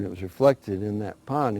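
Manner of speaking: ordinary delivery, nothing marked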